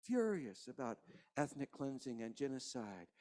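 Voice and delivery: preacher quietly mourning with the congregation; hushed grieving preaching. Real, not acted. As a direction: let the grief sink the voice down. Quiet grieving sermon close.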